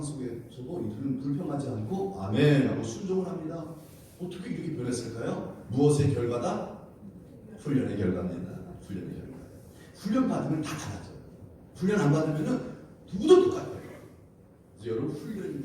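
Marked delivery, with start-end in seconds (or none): 2.2-2.7 loud amen. Acted. Acts obedient Israelites. 13.1-13.7 high, loud burst; preacher's sudden cry; shouted emphasis. Real, not acted. Preacher exclaims.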